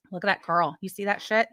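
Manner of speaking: usual speaking voice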